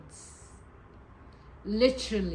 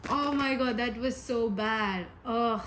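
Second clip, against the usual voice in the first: ordinary talk, nothing marked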